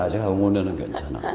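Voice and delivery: ordinary talk, nothing marked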